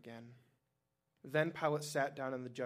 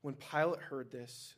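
plain reading voice

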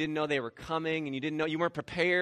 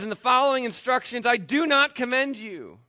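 loud, high, emphatic rebuke; unhappy apostle laying down the law; displeased; 8 semitones higher, 7 dB louder than usual. Acted. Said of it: "is not happy".

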